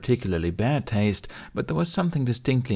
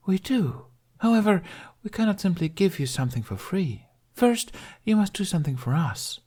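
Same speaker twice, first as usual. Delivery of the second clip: higher, sly siren voice laying down terms; a teasing mermaid naming her price; lightly voiced sea maiden, playful but firm; coy mermaid bargaining; 11 semitones higher than usual. A narrator's reading. Mermaid Mirren sets her terms.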